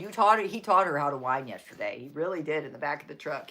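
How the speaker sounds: usual speaking voice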